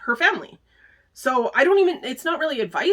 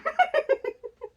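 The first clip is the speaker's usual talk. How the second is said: high, giggly laugh; real high-pitched chuckle; self-amused laugh. Real, not acted. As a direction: giggle at your own bad impression. Laughs at her own bad accent.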